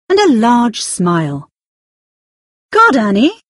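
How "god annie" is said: higher, louder surprised exclamation. Acted. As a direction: exclaim her name loudly. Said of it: "the woman said loudly".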